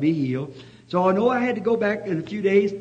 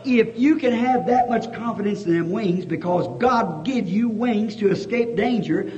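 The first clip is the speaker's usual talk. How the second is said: usual speaking voice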